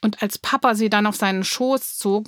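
ordinary delivery, nothing marked

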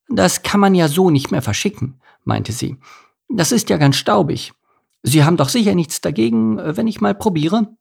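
put-on high, lilting elderly lady voice; high-voiced old lady with sly courtesy; old mouse lady. A narrator's reading. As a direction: coax him politely, pitched well up. Old lady angles for a taste.

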